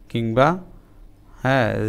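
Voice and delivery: usual speaking voice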